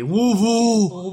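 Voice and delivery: Louisville said slow and squashed, like wool-vul; mock local Louisville. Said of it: "your town is spelled Louisville".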